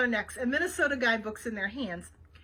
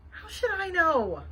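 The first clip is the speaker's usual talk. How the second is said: higher, slow and exasperated; mildly irritated; 5 semitones higher, 4 dB louder, slower than usual. A narrator's reading. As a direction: toss it back, mildly annoyed.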